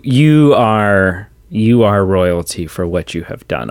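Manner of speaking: usual speaking voice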